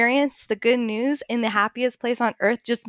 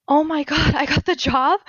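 reliving the shock, higher voice; breathless-style "I can't believe it" reenactment; retold amazed excitement; 5 semitones higher, 4 dB louder than usual. Nurse retelling her job offer call, amazed and excited.

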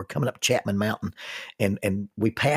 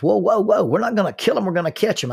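high, loud alarmed whoa; startled stranger pleading not to kill the snake; alarmed objection; 9 semitones higher, 5 dB louder, faster than usual. Retold or imitated. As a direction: rush to stop him from shooting. Snake catcher stops gun use.

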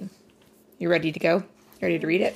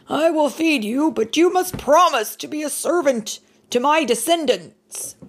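higher and louder, calling out from the window; Noah shouting; 10 semitones higher, 5 dB louder than usual. Acted. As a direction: call down firmly to the giant. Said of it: "Noah shouted from a window".

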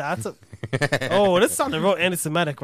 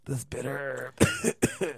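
slow mock solemn; much slower than usual. Repeating phrase mockingly.

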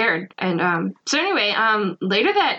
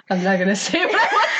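laughing through the words; giggling as she says it; much faster than usual. Real, not acted. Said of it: "said twice".

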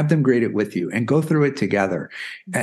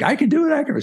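put-on high, excited little-girl plea; higher voice, a child insisting she's ready; eager little girl; faster than usual. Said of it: "she's like".

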